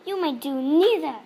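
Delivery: loud sharp snap; snapped. A narrator's reading. Headmistress snapping a harsh no at Nigel. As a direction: snap the refusal at the boy. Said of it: "snapped the Trunchbull".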